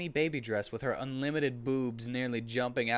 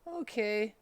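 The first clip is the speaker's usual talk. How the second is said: sad and quiet; 8 semitones higher than usual. Acted. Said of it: "in a sad and quiet way".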